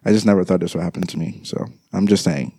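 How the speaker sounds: ordinary talk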